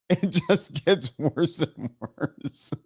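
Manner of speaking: very slow words dissolving into laughter; laughing. Real, not acted. Streamer giving in to tech failures, laughing. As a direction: draw it out through helpless laughter.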